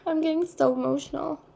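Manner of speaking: moved almost to tears mid-reading; real emotion welling up. Real, not acted. Tarot reader overcome while reading for viewers, emotional.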